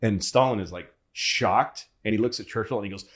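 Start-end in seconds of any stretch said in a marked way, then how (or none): none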